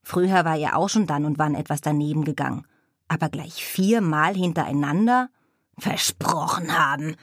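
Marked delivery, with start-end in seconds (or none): 5.8-7.1 a lightly voiced raven croak, mocking. Raven Abraxas mocking the witch's excuse, light croak. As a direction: croak her words back at her. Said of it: "krächzte der Rabe Abraxas".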